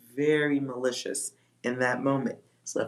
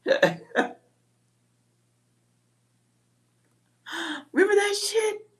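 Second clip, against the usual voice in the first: squeaky rhetorical question. Real, not acted. Rhetorical callback.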